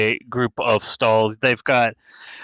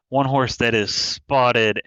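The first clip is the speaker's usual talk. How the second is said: ordinary delivery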